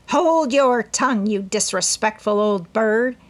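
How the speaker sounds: put-on irritated old woman rebuke; scolding old aunt. A narrator's reading. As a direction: scold the bird sharply.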